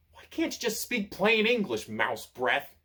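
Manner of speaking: high, irritated snarl. A narrator's reading. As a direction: snap at the pompous owl. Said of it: "snarls Fox".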